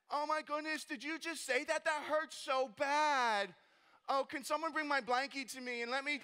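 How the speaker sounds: high-pitched, hammed-up hurt feelings; whiny self-pity. Nehemiah as he would never answer critics, babyish whining. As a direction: whimper like a coddled child, in mockery. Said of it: "I'm making fun of myself".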